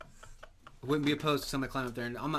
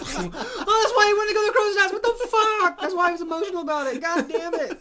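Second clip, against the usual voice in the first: much higher, much louder shocked outburst; shocked disbelief; 11 dB louder than usual. Real, not acted. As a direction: burst out in horrified laughing shock. Shocked realisation of suicide.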